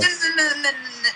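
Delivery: high-pitched string of protesting no's; urgent interjection. Real, not acted. Cuts in to stop host wrapping up.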